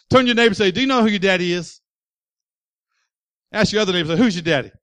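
rally the room; loud preaching call. Real, not acted. Preacher telling the church to ask their neighbors, loudly. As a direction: call out to the crowd, loud and high.

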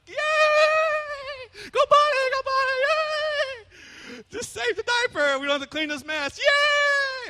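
very high, squealing excited cheer; hammed-up parent celebrating a toddler's potty success.

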